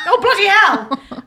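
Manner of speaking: loud laughing shock; shocked exclamation. Real, not acted. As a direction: burst out in laughing surprise. Shocked by Karen under table.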